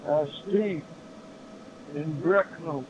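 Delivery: normal talking voice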